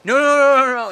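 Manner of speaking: Bobby Heenan pleading. Retold or imitated. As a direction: beg off like a cowering heel. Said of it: "Bobby the Brain Heenan go".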